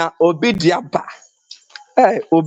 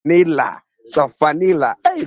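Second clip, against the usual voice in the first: ordinary talk, nothing marked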